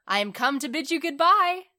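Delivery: high, loud announcement rising at the end; crying out. A narrator's reading. Swallow back to bid prince farewell, calling out. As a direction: call it up to someone, loud and lifted. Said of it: "he cried".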